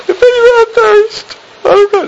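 mocking, very high falsetto voice; high-pitched parody of a timid preacher; mock meek delivery. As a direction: squeak it in a ridiculously high put-on voice. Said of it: "He didn't stand up and say".

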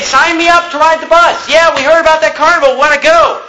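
high-pitched, excited mock clamor; eager shouting. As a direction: imitate a crowd eagerly signing up. Voices eager people approaching the preacher.

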